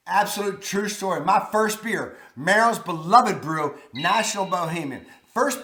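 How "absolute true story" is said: usual speaking voice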